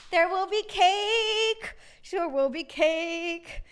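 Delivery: teasing, drawn-out vow; sing-song promise. Pretend. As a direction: stretch each word like a playful chant.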